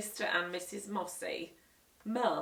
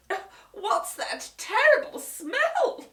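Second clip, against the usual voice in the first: higher, slow sniffing question; disgusted guest; 12 semitones higher, 8 dB louder, slower than usual. Acted. Guest noticing burning smell, disgusted.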